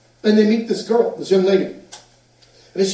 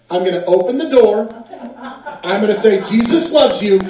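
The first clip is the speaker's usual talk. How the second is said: laughing; 4 semitones higher, 6 dB louder than usual. Retold or imitated. Laughing retelling of plan.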